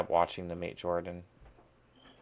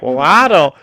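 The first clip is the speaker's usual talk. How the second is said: put-on Southern girl; 7 semitones higher, 14 dB louder than usual. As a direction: ham up a giggly Southern belle. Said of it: "a Southern girl that's like".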